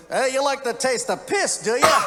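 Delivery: high, loud Aussie bartender impression; mock-confrontational barman, rising on the question; challenging bartender. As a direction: needle the customer, high and punchy. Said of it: "Bartender looked at me".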